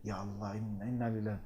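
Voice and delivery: shocked murmur. Retold or imitated. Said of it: "when you see someone crying, you think".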